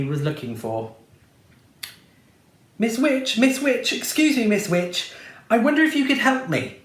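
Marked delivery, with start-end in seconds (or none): 2.8-6.8 prince voice. A narrator's reading. Prince pleading to the witch.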